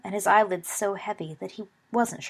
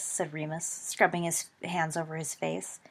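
plain reading voice

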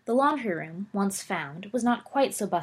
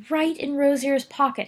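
crowing; 7 semitones higher, 5 dB louder than usual. Acted. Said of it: "he crowed".

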